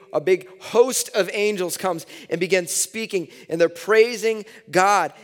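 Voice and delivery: rising excitement. Acted. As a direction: lift it up as the host appears. Building preaching excitement.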